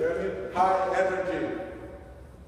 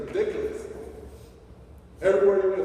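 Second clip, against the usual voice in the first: normal talking voice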